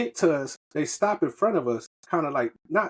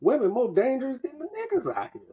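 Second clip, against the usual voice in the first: high, incredulous exclamation, pitched way up; stunned disbelief; 11 semitones higher than usual. Retold or imitated. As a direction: act floored by how dangerous these women are. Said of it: "I was like".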